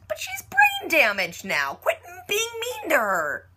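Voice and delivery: drawn-out, high mock whine; pouty pleading. Fan begging Felix to stop bullying Rachel, high pouty whine.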